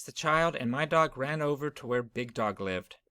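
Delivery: ordinary delivery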